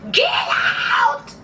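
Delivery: high shout, unvoiced and far off; shouted get out. Producers yelling him out of the house, high shout. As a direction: yell it with all you've got. Voicing the crew kicking him out.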